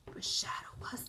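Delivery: hushed whispered line. A narrator's reading. Reader building suspense for the children, whispered. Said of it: "whispered narration for suspense".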